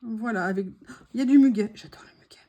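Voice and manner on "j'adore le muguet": murmure sans voix; confie-le tout bas; chuchoté. Real, not acted. (chuchotement complice)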